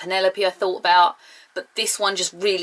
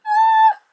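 short high, loud pretend yelp; mock scream; 6 dB louder than usual. Man shot at by a car, mock scream.

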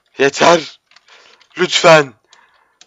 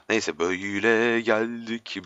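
normal talking voice

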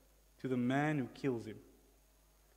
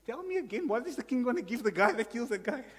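much higher, excited asking; eager boyish voice; 9 semitones higher than usual. Acted. David eagerly asking the soldier beside him, much higher. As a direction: squeak it out like an eager boy.

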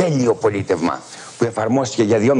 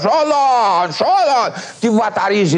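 crying out from the pyre; 10 semitones higher, much slower than usual. Retold or imitated. Croesus on the burning pyre calls Solon, high desperate cry. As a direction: call his name from the flames. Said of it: "φώνασε".

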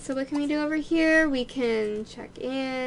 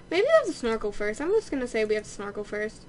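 ordinary talk

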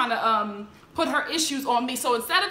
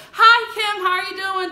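high, loud friendly call across the room; yelled greeting; 7 semitones higher, 8 dB louder than usual. Retold or imitated. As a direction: call over loudly and cheerfully. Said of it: "she yells over".

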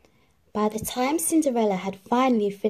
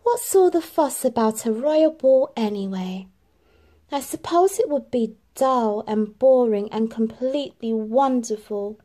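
louder, dreamy, slowing at wonderful; wistful Cinderella; 4 semitones higher, 4 dB louder than usual. A narrator's reading. Cinderella wistful alone, slows dreamily. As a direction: talk yourself out of it, then give in.

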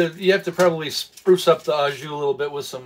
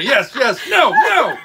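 laughing protest; 8 semitones higher, 6 dB louder, much slower than usual. Real, not acted. Laughing during photo setup.

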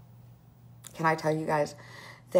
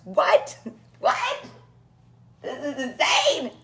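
squeaking much higher, laughing in disbelief; stunned disbelief; 9 semitones higher, 4 dB louder, slower than usual. Real, not acted. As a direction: she can't believe she earned it. Reacting to earning the trip.

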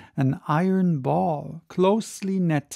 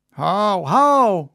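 put-on young listeners begging for the story; eager cry; 2 semitones higher, 5 dB louder than usual. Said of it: "cried all the young Spaniards".

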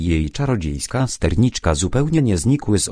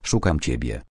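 calm reply; 6 semitones lower, 4 dB quieter than usual. A narrator's reading. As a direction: lower and softer, held-back calm. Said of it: "odpowiedział spokojnie". (spokojnie, cicho i niżej)

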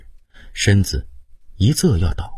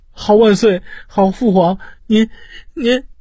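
much higher and louder, breaking into begging; tearful pleading; 4 dB louder than usual. A narrator's reading. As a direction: beg the father through tears, words failing. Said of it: "哭着哀恳".

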